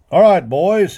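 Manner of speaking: loud, high call across the yard. A narrator's reading. Boss calling his cowboys to the corral, loud call. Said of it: "he called".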